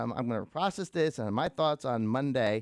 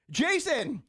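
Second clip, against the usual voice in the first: calling out a name; 13 semitones higher, much slower than usual. Retold or imitated. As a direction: shout the name to catch his attention. Pastor calling out across crowd.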